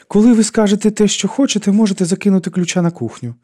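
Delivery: високий тонкий голос персонажки; невдоволено відшити непрохану гостю високим голосом; сварлива жінка. A narrator's reading. Сварлива Карла відповідає непроханій гості. (високо й сварливо)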